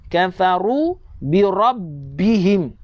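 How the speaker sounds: slow "Kafaru bi rabbihim" with doubled b; clear shaddah. Shown as a demonstration. Teacher points out the shaddah, "Kafaru bi rabbihim" slow with held b. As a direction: hold the b in "rabbihim". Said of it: "Nampak tak sabdu?".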